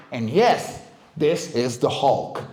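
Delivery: usual speaking voice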